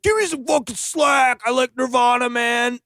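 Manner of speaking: high, shouted mock outburst; Nicolas Cage shout. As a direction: yell it like an unhinged action hero. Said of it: "Nicolas Cage is like".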